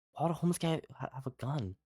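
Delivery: high, hushed and tense; whisper-argue while hiding. Retold or imitated. Friend answering while hiding.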